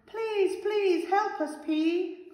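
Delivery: very high, desperate begging; pleading call. A narrator's reading. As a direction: call out for help, imploring. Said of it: "called the veggies".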